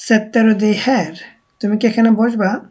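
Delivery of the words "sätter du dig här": jag sätter mig spoken very slowly, word by word; slow careful model sentence for learners; slow Swedish demo. Shown as a demonstration. Teacher modelling a Swedish sentence for students, very slow. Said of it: "তুমি কি এখানে বসবে".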